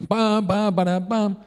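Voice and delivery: high sung-out pam pam news sting; mimicking the Crónica TV alert sound; imitated news jingle. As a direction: hum the breaking-news jingle like TV.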